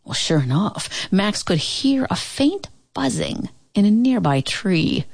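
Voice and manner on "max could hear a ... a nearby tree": ordinary delivery, nothing marked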